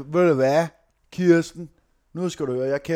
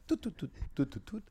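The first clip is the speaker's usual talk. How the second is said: very soft run of keypad beeps; dialling beeps; 13 dB quieter, much faster than usual. Acted. Caller redials as asked, quiet imitated dialling tones. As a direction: tap out the number with tiny mouth beeps. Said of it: "Tast lige ind igen".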